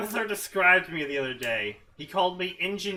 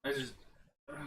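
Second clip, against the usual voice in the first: usual speaking voice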